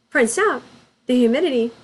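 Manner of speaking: usual speaking voice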